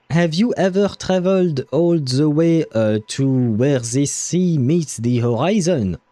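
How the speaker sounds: put-on French accent, voice set apart and slowed. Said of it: "it's hard to speak that way".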